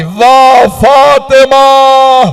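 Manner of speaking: very slow wailed cry of Aliya; long keening lament; lamenting call. Acted. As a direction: draw the name out like a keening call. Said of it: "अपनी मां को आवाज दी".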